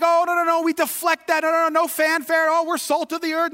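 high-pitched imitation of modest protest; mock deflecting. Preacher mimicking Midwestern Hoosiers, mock deflecting.

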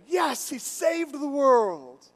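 high, slow, drawn-out mock triumph; triumphant exclamation. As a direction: savor the hero's victory, stretching it out. Acts his triumph at the movie.

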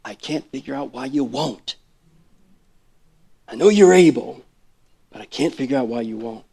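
soft, torn and pleading; a touch of hurt bewilderment; quiet anguished plea. Pretend. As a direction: murmur the doubt to Jesus.

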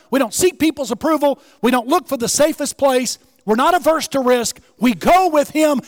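loud, high, punchy preaching; preacher rallying the congregation, loud and high; preaching at full force. Acted. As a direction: pound each line from the pulpit.

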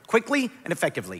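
ordinary talk, nothing marked

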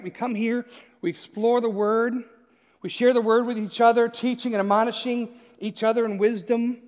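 usual speaking voice

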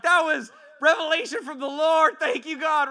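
high, loud triumphant praise; joyful shout. Retold or imitated. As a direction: shout thanks to God, overjoyed. I'm like, thanking God.

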